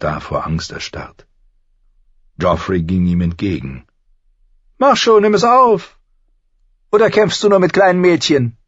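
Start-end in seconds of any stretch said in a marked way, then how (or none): 4.8-8.6 very high, loud taunt; bully him into it, loud and high; goading prince. A narrator's reading. Prince goading the butcher's boy, loud and taunting.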